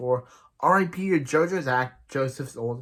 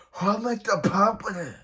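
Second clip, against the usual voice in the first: dramatic stand reveal; 7 semitones higher than usual. Retold or imitated.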